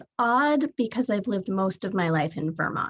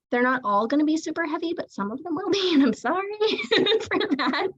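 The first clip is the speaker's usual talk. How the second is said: laughing apology; 9 semitones higher than usual. Real, not acted. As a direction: voice climbing high into a laugh. Apologising for heavy poems with laughs.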